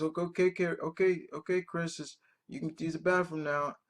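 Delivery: a hint of mom calling sweetly; mother's voice. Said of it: "she says".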